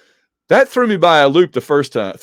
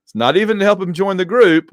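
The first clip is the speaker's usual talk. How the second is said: ordinary delivery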